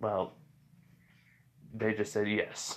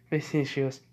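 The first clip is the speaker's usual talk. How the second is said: usual speaking voice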